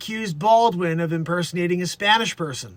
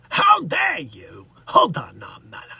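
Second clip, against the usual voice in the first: hammed-up Bronx outburst dropping low; mock-indignant Bronx voice; Bronx accent; 5 semitones lower, 3 dB louder than usual. Host clowning with his character, Bronx accent. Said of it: "That's more of a Bronx vibe we do".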